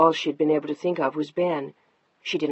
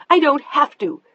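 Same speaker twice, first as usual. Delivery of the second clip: much higher, louder shout; 10 semitones higher, 8 dB louder than usual. Acted. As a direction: yell it at him. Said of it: "she was shouting at him now".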